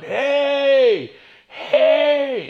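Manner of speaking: high, loud drawn-out holler; yelled warning. Retold or imitated. Guy in a truck hailing a driver who's stuck, yelling. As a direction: holler it like a man calling from a truck.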